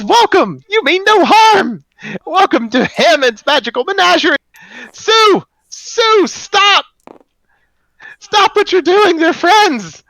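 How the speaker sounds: very high, loud and cracking, an eager stone guide; high cracking golem greeter. Acted. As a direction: squeak it out high and loud like a crystal creature welcoming guests. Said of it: "you can hear the crack in its voice".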